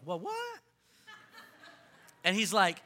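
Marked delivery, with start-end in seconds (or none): 0.0-0.6 a high, startled "what?"; surprised. Retold or imitated. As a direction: jump way up in pitch, caught off guard. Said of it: "Jesus is like".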